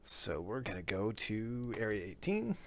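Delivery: ordinary talk, nothing marked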